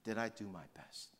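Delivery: reflective question. Retold or imitated. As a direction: ask it quietly of yourself. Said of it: "have you asked that question".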